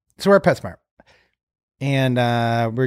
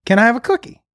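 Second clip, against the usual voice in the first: lightly voiced little girl, higher pitch; daughter asking; 6 semitones higher than usual. Daughter asks parent for a cookie, light child voice. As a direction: lift it like a child asking for a treat. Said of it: "she says to me".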